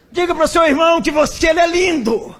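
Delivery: high, loud preacher shout to the crowd; preacher's shout. Retold or imitated. Pentecostal preacher urging the congregation, high loud shout.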